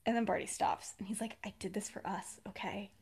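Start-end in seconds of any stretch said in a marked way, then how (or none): 1.4-2.9 much softer, confiding delivery; quiet, intimate admission; hushed confession. Retold or imitated. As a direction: drop your voice and admit it privately.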